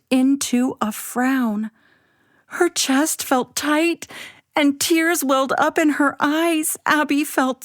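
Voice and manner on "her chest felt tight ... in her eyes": plain reading voice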